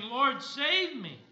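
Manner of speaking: loud, high, drawn-out plea; crying out. Acted. Preacher voices sinking Peter calling to Jesus, acted loud cry. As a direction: call out slowly for rescue, acted from scripture. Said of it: "he cried".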